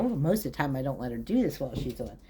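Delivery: usual speaking voice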